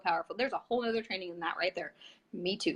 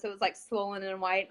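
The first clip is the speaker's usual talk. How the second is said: ordinary delivery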